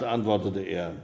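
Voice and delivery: plain reading voice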